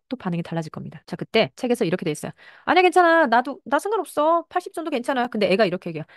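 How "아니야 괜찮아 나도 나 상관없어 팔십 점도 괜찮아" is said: high, loud, insistent reassurance from mom; reassuring mother. Acted. Mother insisting to her child that eighty is fine, high and loud. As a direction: brush it off brightly, pitched up.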